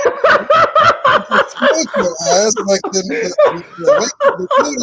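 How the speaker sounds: slow, high, loud laughing words; laughing hard mid story. Real, not acted. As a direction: stretch it out cracking up. Laughing while retelling.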